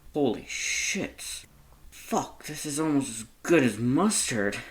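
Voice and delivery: put-on drunk monster, slow then laughing; boozy delight with a laugh; tipsy monster voice. Drunk monster tasting ice cream.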